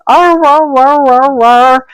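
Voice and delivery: high yowling imitation of a dog's song; high, playful dog-song arr sounds; dog singing. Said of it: "As soon as my hands got close".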